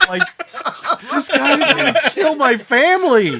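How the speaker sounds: squeaking high with real laughter; laughing. Real, not acted. GM cracks up describing the terrified Malcolm, laughing. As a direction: laugh through the words.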